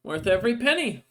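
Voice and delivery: lightly acted smiling seller; smiling merchant. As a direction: say it with a satisfied smile, lifted pitch. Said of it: "She smiles at you and goes".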